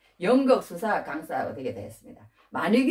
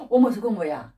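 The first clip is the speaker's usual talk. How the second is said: sharp gasp, then louder alarmed question; agitated shock; 5 semitones higher, 3 dB louder than usual. Retold or imitated. Father-in-law seeing the sturdy lambs, agitated shock. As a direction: gasp in mock dismay at the sight. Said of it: "라반이 막 불불 끓는 거야".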